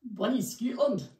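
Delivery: badly put-on Scottish brogue; terrible Scottish accent. Announcing the day's destination in a terrible Scottish accent. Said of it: "Terrible accent".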